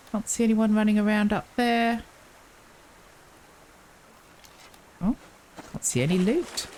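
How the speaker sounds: alert, high, chirpy lookout voice; meerkat impression. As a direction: pop up and scan like a meerkat. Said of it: "Enough of the meerkat impression".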